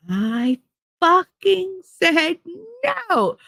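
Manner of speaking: high, slow, defiant; boy yelling. Retold or imitated. Boy screaming at his mother, high and dragged out. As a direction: stretch it out high like a furious kid.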